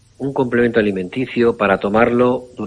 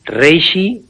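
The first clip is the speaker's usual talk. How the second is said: "Reishi" higher and louder, rising; emphatic name; 7 semitones higher, 6 dB louder than usual. Shown as a demonstration. Doctor announcing the supplement name to radio listeners, emphatic.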